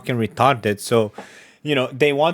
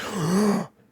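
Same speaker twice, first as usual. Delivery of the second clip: mock gasp; 6 semitones higher, 6 dB quieter than usual. Mock shocked fan reaction.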